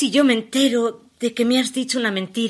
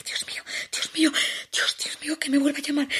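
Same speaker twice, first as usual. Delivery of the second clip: fast whispered prayer, softer; 8 dB quieter, faster than usual. Acted. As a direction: pray in a frantic whisper. Praying the phone rings again. (rezo susurrado)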